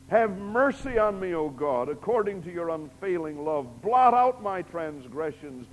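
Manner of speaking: loud, high fervent plea; impassioned preaching. Real, not acted. Preacher voicing David's plea to God, fervent. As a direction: pour it out as a prayer.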